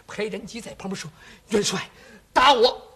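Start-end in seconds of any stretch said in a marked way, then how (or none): 1.5-2.9 firm cry. Acted. 老将坚决请打.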